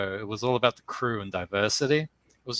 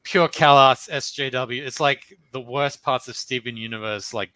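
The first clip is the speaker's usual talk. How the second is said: ordinary talk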